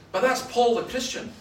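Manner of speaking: loud, high retort, imitated; having a go. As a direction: fire back like an argumentative heckler. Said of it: "then he started having a go".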